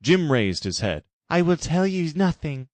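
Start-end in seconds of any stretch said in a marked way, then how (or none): 1.3-2.7 high, defiant refusal; defiant Jim. Acted. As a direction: refuse through the pain.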